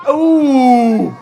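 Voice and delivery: long, loud falling "ooh"; drawn-out spectator's ooh at a big hit; impressed ooh. Real, not acted. Commentator reacting to the robot fight, impressed ooh.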